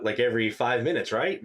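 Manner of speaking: ordinary delivery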